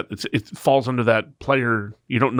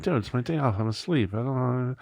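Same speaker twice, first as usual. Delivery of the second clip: soft, reluctant mumbled refusal; sleepy protest; 2 semitones higher, 5 dB quieter than usual. Retold or imitated. Half-asleep teenager on the phone to his boss, soft sleepy whine. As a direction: protest softly, as if still in bed.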